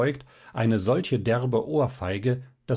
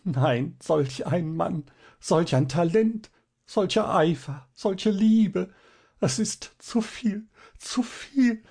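stammering, building from high to much higher sobs; sobbing; 8 semitones higher, slower than usual. A narrator's reading. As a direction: overcome with tears, voice climbing and breaking. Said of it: "stammelte und schluchzte".

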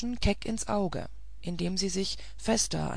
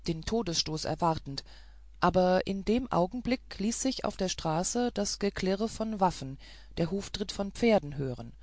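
ordinary delivery

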